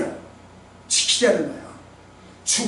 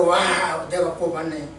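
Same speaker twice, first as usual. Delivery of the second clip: ordinary talk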